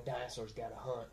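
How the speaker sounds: low, quiet tough-guy growl; gruff accent. Retold or imitated. Co-host imitating Pratt in Jurassic World, gruff accent. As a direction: imitate a gruff movie hero, kept soft. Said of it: "the gruff accent".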